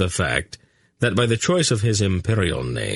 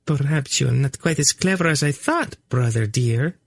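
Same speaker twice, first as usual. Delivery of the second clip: a sly purr, pitched higher; purring tease; 7 semitones higher than usual. A narrator's reading. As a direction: tease him sweetly, like a cat. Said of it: "she purred at him".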